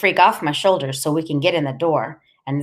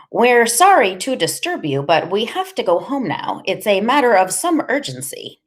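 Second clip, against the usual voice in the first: ordinary delivery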